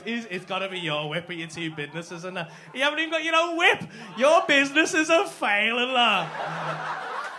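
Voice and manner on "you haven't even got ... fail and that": loud, high laughing jibes; loud cackling mockery; laughing roast. Real, not acted. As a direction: crack up while roasting him.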